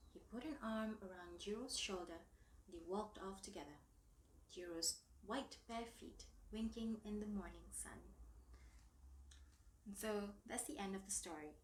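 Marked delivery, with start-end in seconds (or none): none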